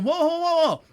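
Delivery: high, loud mock alarm; a hammed-up startled whoa. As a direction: pull back fast, startled and loud. Retold reply to angry commenter.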